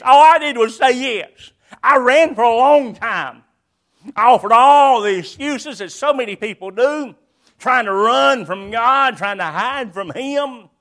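loud, driving preacher's testimony; fervent preaching. Real, not acted. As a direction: preach it out to the congregation. Preaching at full force.

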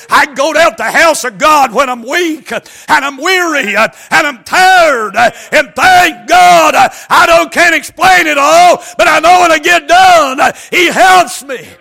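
high, loud, shouted sermon cadence; full-force preaching. Acted. Preacher to congregation, loud shouted preaching. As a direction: drive the scripture home with everything you've got.

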